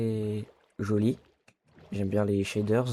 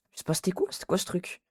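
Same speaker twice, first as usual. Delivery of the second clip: rattled, higher-pitched voice; real fright; 8 semitones higher, faster than usual. Real, not acted. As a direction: still shaken by a scare. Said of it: "il m'a fait tellement peur".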